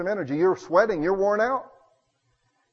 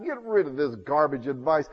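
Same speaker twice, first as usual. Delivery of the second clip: usual speaking voice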